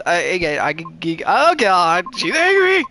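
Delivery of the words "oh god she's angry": high, loud frightened wail; fearful yelp at the angry banshee; panicked cry. Acted. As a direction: shriek it in terror. Banshee rages again.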